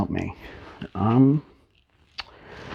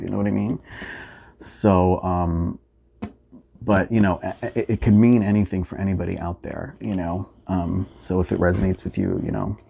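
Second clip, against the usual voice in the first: ordinary talk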